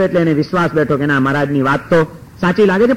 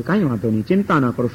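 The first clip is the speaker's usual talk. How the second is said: much lower and softer, soothing; calm reassurance; 8 semitones lower, 6 dB quieter than usual. Acted. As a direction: settle the crying family gently. Narad calms the grieving family. (Naradji શાંત, ધીમા અવાજે આશ્વાસન)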